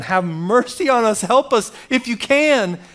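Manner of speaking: high, pressing cry for mercy; emotional plea. Retold or imitated. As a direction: plead with rising urgency, acted out. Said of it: "don't read this without emotion that the father's going".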